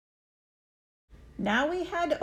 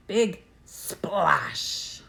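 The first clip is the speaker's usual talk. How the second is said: whispered; 2 semitones lower than usual. A narrator's reading. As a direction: whisper the big splash. Whispered story sound effect.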